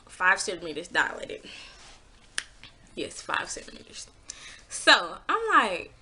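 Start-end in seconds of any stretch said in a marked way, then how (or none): 2.9-4.1 breathless hushed disbelief; stunned whisper. Real, not acted. She tells viewers how far along she was, hushed whisper. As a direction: whisper it in awe.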